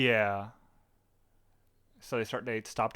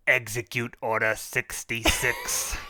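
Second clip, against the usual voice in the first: Palpatine voice; 3 dB louder, slower than usual. Retold or imitated. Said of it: "I was about to go".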